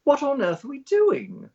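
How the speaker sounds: high-pitched, indignant British woman; J K Rowling. Retold or imitated. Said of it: "She goes, she goes".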